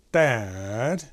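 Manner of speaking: DAD drawn out and swooping downward; falling emphasis. Retold or imitated. Animator copies the voice actor's line, falling emphasis on DAD. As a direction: stretch DAD out, letting the tone sink. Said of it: "going down".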